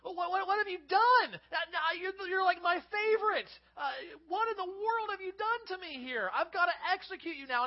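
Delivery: high, loud despairing cry; anguished king. Retold or imitated. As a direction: wail it out like a king who has trapped his favourite. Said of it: "He's like".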